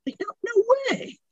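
high buccal cartoon duck voice; Donald Duck voice. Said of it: "you do the Donald Duck voice".

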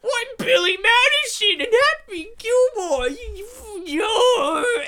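high, wailing comic tantrum; outraged man-child squawking loudly; hammed-up sputtering star meltdown; Adam Sandler. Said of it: "And Adam's like".

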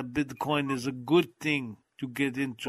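put-on thick Russian accent; Ivan impression. Passing on Bitcoin advice to cohost, thick Russian accent. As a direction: imitate a Russian YouTuber pitching Bitcoin. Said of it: "I just like talking like this".

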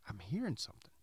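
hushed whisper, no voice. Retold or imitated. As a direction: whisper it so as not to wake anyone. Talking to son at 3am.

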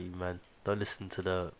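usual speaking voice

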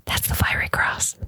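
voiceless whisper to a seatmate; hushed, secretive aside; confiding whisper. Retold or imitated. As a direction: lean in and whisper the reveal. Leans over to share a secret.

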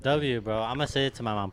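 normal talking voice

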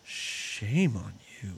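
low, slow scolding voice; a deep, drawn-out shaming tone; scolding mother. Retold or imitated. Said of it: "Lady Kazan is like".

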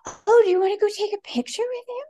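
high, bright-eyed offer of a photo; chirpy assistant. Retold or imitated. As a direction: play the eager helper. Assistant's cheery offer voiced.

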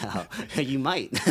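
slow teasing words through laughter; laughing. Real, not acted.

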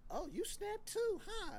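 high, slow mocking wife; imitating wife. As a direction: tease him like his wife would. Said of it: "she probably be like".

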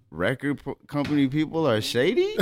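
rising question tune on shady. Retold or imitated. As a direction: end company people are shady on a rise, as if unsure. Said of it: "I straight say it like that, like a question mark".

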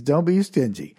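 ordinary delivery

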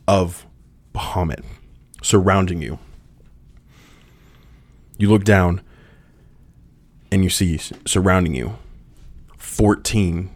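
measured scene-setting with dramatic pauses; game master narration. Acted. Game master setting the stone hall for Kane, dramatic narration. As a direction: narrate in punched phrases, pausing between them.

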